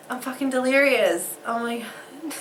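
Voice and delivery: ordinary delivery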